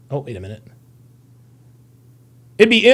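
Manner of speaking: normal talking voice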